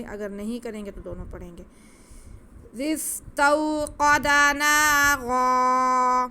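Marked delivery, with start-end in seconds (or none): 2.8-6.3 نارا ending stretched as ناراa, pausing on the ر; pause on naara. Shown as a demonstration. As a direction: chant slowly and loud, holding the final ra. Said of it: "وقف کریں گے تو ایسے ہم را کو کھینچ کے پڑھیں گے".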